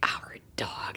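a stunned whispered echo; disbelieving whisper. Retold or imitated. Wife repeating husband's claim in a stunned whisper. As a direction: breathe the words out, unvoiced.

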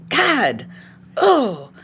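acted cursing after each small bump; frustrated bursts with pauses between; getting madder. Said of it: "I'm getting madder, and I'm going".

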